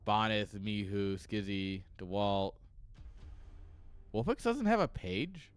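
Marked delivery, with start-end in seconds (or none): 4.1-5.5 rising, higher incredulous question; can't believe the page is missing; surprised disbelief. Real, not acted. Scrolling the wiki to chat, higher disbelieving question.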